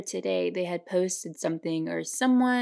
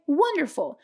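cheerful vendor; 7 semitones higher than usual. Pretend. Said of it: "And he'll say".

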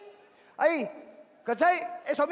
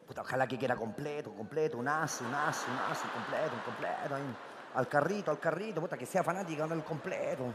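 much lower and softer, under the breath; muttered inner thought; 10 semitones lower, 11 dB quieter than usual. Retold or imitated. Hoping she wants a cheap hot dog, low muttered prayer. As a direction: pray to yourself under your breath.

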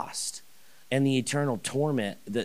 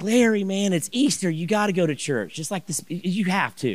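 higher, louder, insistent plea; pushy urging; 7 semitones higher, 3 dB louder than usual. Retold or imitated. Urging Larry to church at Easter, loud and pushy. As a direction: press him hard about Easter.

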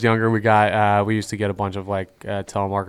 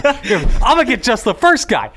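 mock scammer voice; 12 semitones higher, 5 dB louder, slower than usual.

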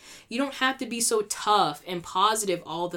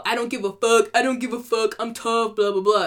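mocking tough-person act, pitched up; 3 semitones higher, 5 dB louder than usual. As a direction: put on a louder, higher tough act. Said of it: "like, mad tough".

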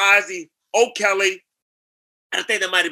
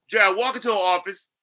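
ordinary delivery

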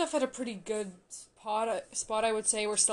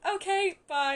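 much higher quick chirpy bye; imitating a hasty goodbye; 11 semitones higher, 6 dB louder than usual. Retelling Charlie leaving Nick, high hasty bye. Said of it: "I love how he's just like".

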